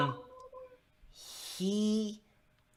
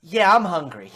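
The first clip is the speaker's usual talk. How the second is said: raised, loud character voice; higher, louder traveller voice; stranger at the door; 4 semitones higher, 9 dB louder than usual. Acted. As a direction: answer eagerly as a hungry traveller. Game master voices the stranger.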